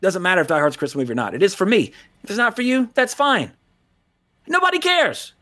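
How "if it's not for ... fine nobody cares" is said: high, heated kiss-off; peaking rant. Real, not acted. Ending his soapbox rant, high heated kiss-off. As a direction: throw it away, fired up.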